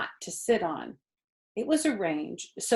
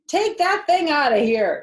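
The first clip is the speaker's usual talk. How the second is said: higher, louder disgusted order; Mrs Zuckerman ordering; 9 semitones higher, 9 dB louder than usual. A narrator's reading. Farm wife ordering boy to remove frog, loud and high. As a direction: get that frog away from my kitchen. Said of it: "said Missus Zuckerman".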